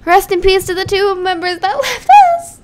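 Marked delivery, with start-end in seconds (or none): none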